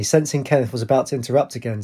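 ordinary delivery

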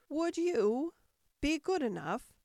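high, winded, broken request; panting. A narrator's reading. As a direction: pant it out in short pieces. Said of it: "Alice panted out".

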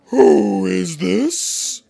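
very slow, loud, high imperious demand; severe. Acted. Queen demanding of the Knave, slow, loud and severe. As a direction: bear down on them like a tyrant queen. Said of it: "the Queen said severely".

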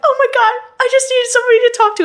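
high, loud distressed mom; mock-panicked mother on the phone; mom's frantic voice. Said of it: "sounded like she was crying".

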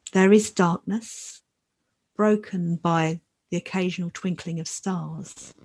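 calm and unhurried, long pauses; guided meditation. Acted. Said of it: "So now I'd like to lead us in a short new moon meditation".

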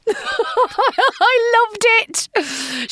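laughing high through the words; giggling delight as you say it. Real, not acted. Vlogger delighting in the mix-up, laughing.

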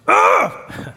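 loud, high wordless groan of frustration; hammed-up exasperated "ugh". Out of words with God, frustrated groan. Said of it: "you run out of words with God".

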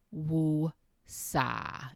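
slow, split woo, then sah; long woo-sah breath. Shown as a demonstration. Coach demonstrating a calming woosah to parents. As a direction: breathe the woosah out in two parts. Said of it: "before you engage".

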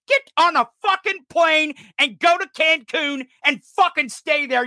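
ordinary talk, nothing marked

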